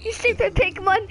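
usual speaking voice

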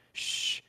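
quick unvoiced shush; retold hushing sound. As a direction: hush someone with a soft "shh". Retold character shushing someone mid-sentence.

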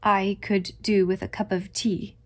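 plain reading voice

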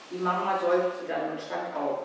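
ordinary delivery

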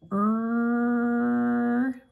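"er," as one long held r sound; letter sound R. Shown as a demonstration. Teacher sounding out red for young learners, long held r. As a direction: draw out "er," as a steady growled r. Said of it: "red robots letter".

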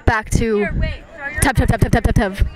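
lively amateur sports commentary; live play-by-play. Acted. Friends filming a casual match, calling it like sportscasters. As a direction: call the match like a stadium announcer, half joking.

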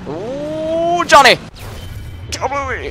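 very high squealed cheer; excited whoop. Real, not acted. Whooping after a game shot.

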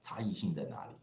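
ordinary talk, nothing marked

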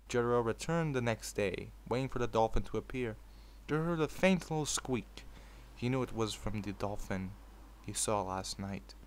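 story narration. A narrator's reading. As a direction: read it like a bedtime fanfic. Reads fanfic aloud.

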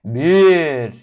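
çok gür, biraz tiz "bir" diye bağır; yüksek sesle sayma. Acted. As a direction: atlamadan önce bağırarak say. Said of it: "Biraz yüksek sesle söyleyeceğim". (atlayışa gaz veren gür sayım)

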